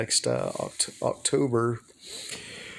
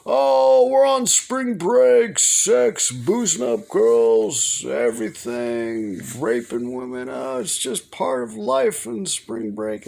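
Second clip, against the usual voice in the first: high, loud, slow party-bro voice; mocking frat guys; 7 semitones higher, 3 dB louder, slower than usual. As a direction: ham up a boastful spring breaker. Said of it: "It's like".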